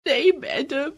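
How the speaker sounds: put-on a child crying to her teacher. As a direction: sob out the words in despair. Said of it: "रोते हुए बोली".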